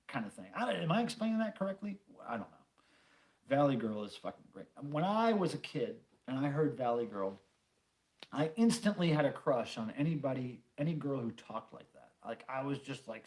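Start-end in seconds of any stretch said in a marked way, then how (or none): none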